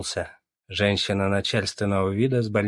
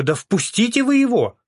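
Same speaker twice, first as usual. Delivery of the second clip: shrill queue voice; 14 semitones higher, 6 dB louder than usual. A narrator's reading. Queue member yelling.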